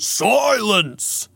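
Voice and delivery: high, drawn-out theatrical roar; lion's yell. A narrator's reading. Giant lion cutting Elias off, yelling. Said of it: "the lion yelled".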